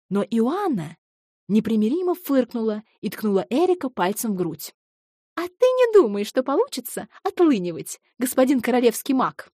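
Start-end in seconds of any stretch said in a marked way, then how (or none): 5.4-9.5 high, teasing reproach; playful indignation. Acted. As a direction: poke at him playfully. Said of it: "заявила она". (игриво-возмущённо)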